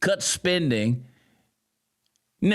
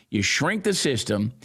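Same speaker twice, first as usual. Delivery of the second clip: ordinary delivery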